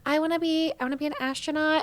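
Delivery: high, quick child imitation; high-pitched kid voice; little kid voice. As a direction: imitate a small kid announcing a dream. Imitating kids naming dream jobs.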